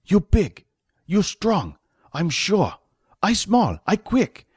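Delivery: high-pitched character voice in short bursts; high voice, broken speech, rising insistence; terse, irritable boss. Acted. Voices Fat in the story.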